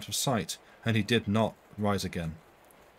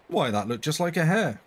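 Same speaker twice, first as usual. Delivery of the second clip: higher, louder exclamation; startled cry; 6 semitones higher, 4 dB louder than usual. A narrator's reading. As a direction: blurt it out in surprise. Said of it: "cried the clergyman".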